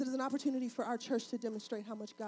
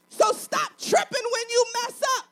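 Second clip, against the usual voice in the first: raised, emphatic preacher's delivery; full-force preaching; 10 semitones higher, 10 dB louder than usual. Real, not acted. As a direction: drive it home to the congregation, building in force.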